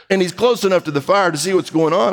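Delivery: normal talking voice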